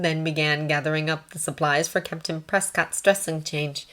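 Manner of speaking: plain reading voice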